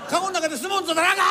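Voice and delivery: a bearer yells at passengers wrestling inside the palanquin; exasperated shout. Acted. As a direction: yell at them to stop wrestling.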